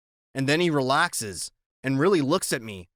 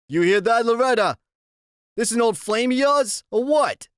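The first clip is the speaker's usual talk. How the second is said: louder, higher taunting biker; 9 semitones higher, 6 dB louder than usual. A narrator's reading. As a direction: jeer at the kid, loud and pushy.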